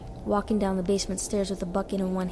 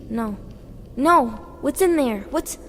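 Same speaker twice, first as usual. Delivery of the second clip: panicked shout; 4 semitones higher, 5 dB louder, slower than usual. A narrator's reading. Boy sees bucket of insects.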